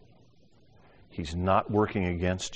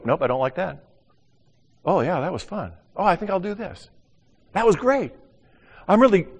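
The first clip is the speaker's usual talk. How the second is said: trying things out; 10 semitones higher, 4 dB louder than usual. Retold or imitated. Voicing someone trying things out.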